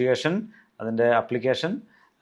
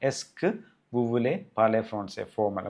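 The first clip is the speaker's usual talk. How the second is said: slow example; much slower than usual. Shown as a demonstration.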